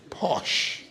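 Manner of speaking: whispered posh. Pretend.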